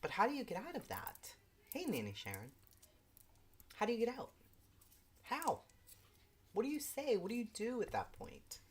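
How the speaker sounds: desperate, how do you even get out; exasperated. Real, not acted. Rising exasperation.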